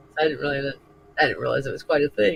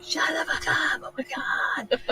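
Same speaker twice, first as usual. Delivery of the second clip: exasperated yell; 13 semitones higher, 4 dB quieter than usual. Retold or imitated. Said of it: "You hear Shell all the time".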